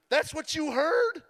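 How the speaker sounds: high, slow, disbelieving question; can't believe what she heard; incredulous. Retold or imitated. Incredulous at wife's reading.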